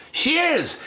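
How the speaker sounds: high-pitched mock outburst; indignant retort. Girl in rollerblades defending her insult, indignant. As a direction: fire it back high, imitating an accused girl. Girl yells back in retold fight.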